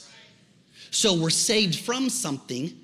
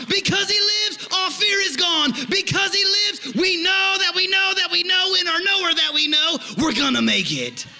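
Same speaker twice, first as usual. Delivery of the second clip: loud, high, fervent preaching; preaching at full force; 7 semitones higher, 6 dB louder than usual. Real, not acted. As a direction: build to a roaring climax.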